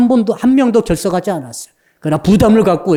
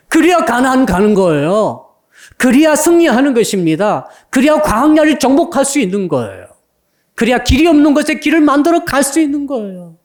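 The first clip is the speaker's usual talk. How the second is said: higher and higher with each 그래야; building preaching climax; 7 semitones higher than usual. Acted. Preacher rallying the congregation, rising preaching climax. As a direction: build each line up toward a triumphant peak.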